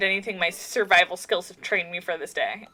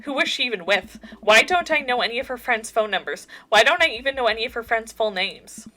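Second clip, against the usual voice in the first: ordinary talk, nothing marked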